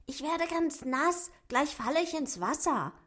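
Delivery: high, whiny little dwarf; a dwarf's high moaning complaint; whining.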